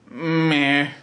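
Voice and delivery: drawn-out bleat of indifference; unimpressed, sheep-like meh; bored sheep. Real, not acted. Reviewer on the dinosaurs episode, like a bored sheep. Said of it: "a bored sheep when I go".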